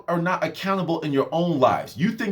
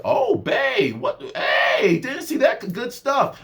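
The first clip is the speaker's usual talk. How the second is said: gushing praise; 7 semitones higher, much slower than usual. Retold or imitated. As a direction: hype up your girl with a shout. Gushing to his woman.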